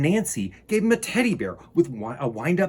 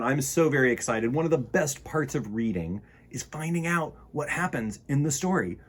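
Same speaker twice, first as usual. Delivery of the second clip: usual speaking voice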